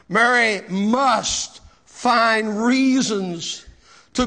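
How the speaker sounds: loud, slow, drawn-out pulpit delivery; emphatic preaching. Real, not acted. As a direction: proclaim it forcefully, stretching the key words. Preaches at full force.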